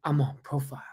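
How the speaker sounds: whispered mock excuse; reel impression. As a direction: whisper it like a feeble soldier. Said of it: "that reel? It's like".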